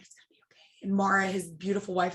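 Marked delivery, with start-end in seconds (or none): 0.0-0.9 whispering. Retold or imitated. Husband reassuring before the flight.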